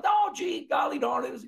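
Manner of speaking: put-on high-pitched aw-shucks country boy; Huckleberry Finn. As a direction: put on a folksy, golly-gee voice. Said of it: "reminds me of Huckleberry Finn".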